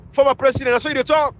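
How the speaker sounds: a scornful mock of the president's bragging; mocking boast.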